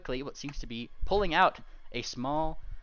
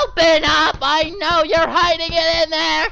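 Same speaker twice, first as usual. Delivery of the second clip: much higher, much louder pounding-door yell; shouting; 15 dB louder than usual. Acted. Pink pony shouting at the locked door. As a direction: bang on the door and holler.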